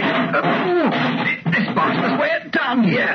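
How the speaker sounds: high, strained call while heaving a crate; high, effortful shout under heavy lifting. Acted. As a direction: shout it out mid-effort, short of breath. Calling out while shifting crate.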